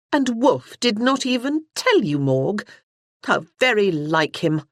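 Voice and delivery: a touch of scorn at the brother; lightly voiced scornful sister. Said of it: "Freya said scornfully".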